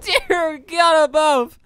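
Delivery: slow, high, half-crying gasp of awe; overwhelmed by the blast, drawn out; awed disbelief. Real, not acted. Gamer watching the giant explosion, overwhelmed and slow.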